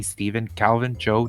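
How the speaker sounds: ordinary delivery, nothing marked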